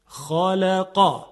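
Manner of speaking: خالقا very slow and loud with a thick خ and a heavy ق; the heavy emphatic letters. Shown as a demonstration. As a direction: draw out خالقا with full weight.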